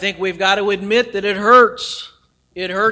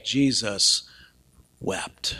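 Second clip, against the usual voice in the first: hushed; 9 semitones lower, 5 dB quieter, much slower than usual. Real, not acted. Solemn verse delivered.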